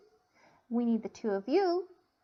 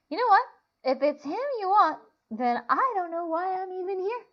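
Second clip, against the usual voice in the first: high, loud exasperated rant; angry outburst; 8 semitones higher, 4 dB louder than usual. Acted. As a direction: storm off shouting. John storming off angrily.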